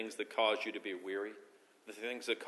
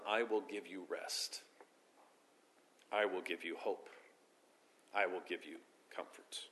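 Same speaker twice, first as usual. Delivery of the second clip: tender promise; 4 semitones lower, 9 dB quieter than usual. Acted. God's promise voiced, tender.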